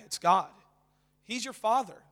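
normal talking voice

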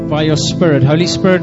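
normal talking voice

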